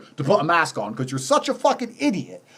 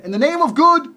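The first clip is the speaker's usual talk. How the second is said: mock pious slogan; 9 semitones higher, 4 dB louder than usual. Mock sanctimonious slogan chant.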